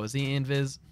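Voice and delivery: normal talking voice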